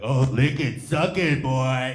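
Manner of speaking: lightly voiced, punchy jeer; mock bully taunt. Bully teasing a kid about a popsicle, mock taunt.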